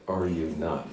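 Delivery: a lightly voiced prissy little den mother; imitating Judy's prim manner. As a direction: mimic her stiff formal question. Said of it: "She spoke like that".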